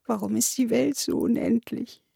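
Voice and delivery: put-on childlike, weepy despair; tearful wail. A narrator's reading. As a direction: whine it through acted tears. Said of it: "heulte er".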